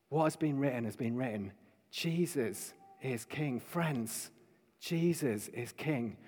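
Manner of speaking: hushed, earnest invitation; soft appeal. Real, not acted. Preacher asking friends to receive Jesus as king, soft appeal. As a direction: drop right down and appeal gently to the listeners.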